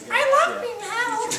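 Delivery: very high, slow squeaky bunny voice; cute rabbit voice. Pretend. Pastor voicing the bunny in a perfect garden, high and slow. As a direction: coo it like a cuddly little rabbit.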